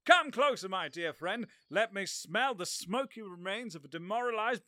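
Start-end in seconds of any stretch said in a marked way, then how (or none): none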